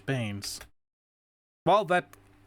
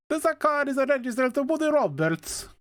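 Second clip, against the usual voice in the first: high, hammed-up Italian accent; heavy Italian accent; 11 semitones higher than usual. Officer Gillespie describing the body in the trunk, in a heavy Italian accent. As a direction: play the cop as a thick Italian accent. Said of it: "It is heavy Italian accent".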